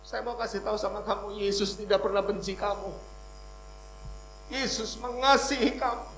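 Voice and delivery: forceful preacher's delivery; preaching. Real, not acted. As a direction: proclaim it to the congregation, building in force.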